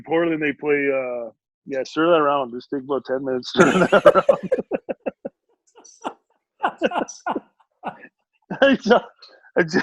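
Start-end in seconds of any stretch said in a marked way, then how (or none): 3.5-4.2 laughing aside. Real, not acted. Jokes to wife off-mic. 6.6-7.4 high laugh. Real, not acted. Laughs at wife interrupting.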